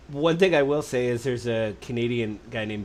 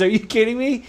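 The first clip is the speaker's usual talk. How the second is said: disbelief; 10 semitones higher, 6 dB louder than usual. Retold or imitated. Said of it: "He goes".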